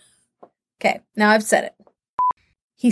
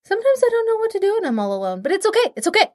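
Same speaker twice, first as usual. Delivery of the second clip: higher, faster whiny lonely voice; playfully pitiful self-talk; mock self-pity; 8 semitones higher, faster than usual.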